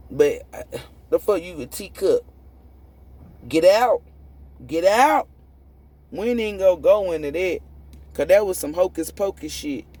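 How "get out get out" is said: louder, higher dismissive "Get out"; exasperated get out. Real, not acted. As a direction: shoo them away loudly. Dismissing critics loudly.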